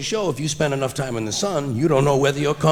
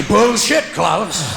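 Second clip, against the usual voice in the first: indignant shout; 2 semitones higher, 4 dB louder, much slower than usual. Pretend. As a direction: snap it back at Klaus.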